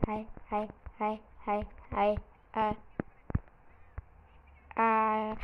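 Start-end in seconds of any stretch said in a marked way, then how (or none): none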